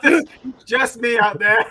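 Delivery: high, loud, through laughter; laughing imitation. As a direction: mimic him while cracking up. Laughing while mimicking the lonely reply.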